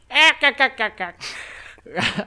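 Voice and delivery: high, rattling "ak-ak-ak" cartoon cackle; mock cartoon sailor cackle; Popeye laugh. Shown as a demonstration. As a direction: chuckle like Popeye in staccato bursts. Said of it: "I just know the laugh".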